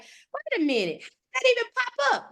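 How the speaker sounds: rising, puzzled wait a minute; mock baffled rise; mock surprise. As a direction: imitate someone startled by an ad. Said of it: "You're like".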